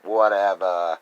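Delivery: mock tough dismissal; dismissive brush-off. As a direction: wave them off hard, loudly. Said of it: "you're just able to be like".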